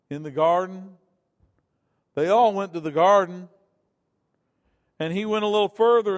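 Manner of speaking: loud, rising preacher's cry; preaching. Acted. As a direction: preach it out to the congregation. Preaching builds in volume.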